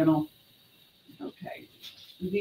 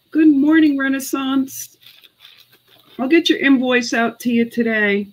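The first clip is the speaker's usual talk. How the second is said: cheery greeting; 7 semitones higher, 4 dB louder than usual. Real, not acted. Greeting viewer joining live.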